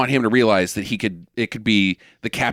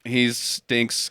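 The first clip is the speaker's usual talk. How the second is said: usual speaking voice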